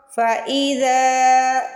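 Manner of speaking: फ़इज़ा slow with a long drawn ज़ा; stretched zal. Shown as a demonstration. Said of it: "जाल को stretch करेंगे". (ज़ाल को खींच कर)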